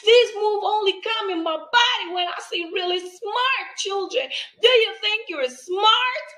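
high, loud, beaming praise; playful teacher. Retold or imitated. Teacher showing kids her silly dance move. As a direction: hype the child up. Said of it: "I say".

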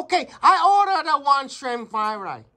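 complaining customer in a mock Chinese accent. Customer complaining about small shrimp, in a Chinese accent. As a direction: grumble through a put-on Chinese accent. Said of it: "we're gonna do a Chinese accent".

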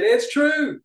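very high, loud exclamation; exclamation point. Shown as a demonstration. Editor repeating his own exclaimed remark, emphatic exclamation. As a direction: push the inflection right up. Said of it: "gave an exclamation point".

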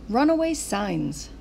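ordinary delivery, nothing marked

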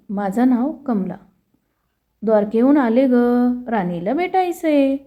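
ordinary delivery, nothing marked

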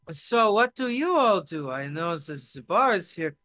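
put-on accented small talk, slowing down; tipsy foreign guest. Drunk guest asks the group about work, tipsy and accented.

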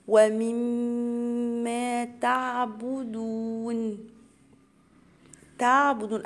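drawn-out tajweed chant, each syllable held; slow Quran recitation. Shown as a demonstration. Teacher recites the verse to students as a slow chant. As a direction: recite it slowly for the students, holding the long vowels. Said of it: "غنة بمقدار حركتين".

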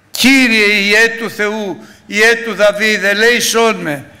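slow, loud, high desperate plea; crying out for mercy. Retold or imitated. Blind Bartimaeus crying out to the Lord, loud and slow. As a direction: beg loudly like the blind man. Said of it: "και φώναξε".

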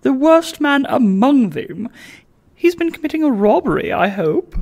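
high-voiced lady hoping for a crime; eager gossiping lady. Lady Bowley relishing gossip.

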